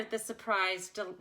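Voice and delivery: ordinary delivery, nothing marked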